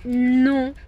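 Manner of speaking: loud, high sharp no; firm no. Real, not acted. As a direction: stop the dog with a loud no. Scolding the dog.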